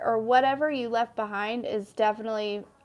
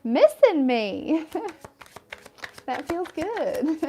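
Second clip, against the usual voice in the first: slow, higher Southern drawl with a breathy laugh; southern accent; 6 semitones higher, 4 dB louder, slower than usual. Pretend. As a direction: drawl it out Southern-style, savoring it. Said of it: "I don't know what this southern accent is".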